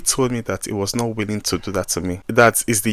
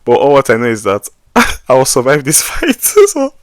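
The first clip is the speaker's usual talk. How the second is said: laughing through the line; 6 dB louder than usual. Real, not acted. Laughing while retelling facing the police officer. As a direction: let the laugh break in at the end.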